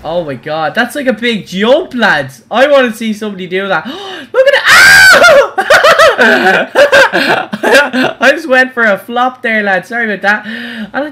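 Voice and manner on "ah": high, loud yelp mid-crash; sudden scream. Real, not acted. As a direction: scream out as the jump goes wrong. Sudden crash during the jump.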